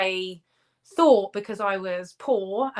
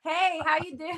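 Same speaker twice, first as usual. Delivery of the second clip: higher-pitched Californian sorority-style American accent; 8 semitones higher than usual. Shown as a demonstration. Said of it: "The only American accent I can do".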